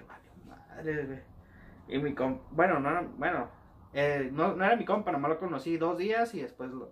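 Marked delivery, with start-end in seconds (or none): 0.0-1.2 whispered under the breath, drawn out; whispered curse. Retold or imitated. Recruit cursing to himself as they stand there, whispered. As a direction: curse to yourself so nobody hears. Y dije.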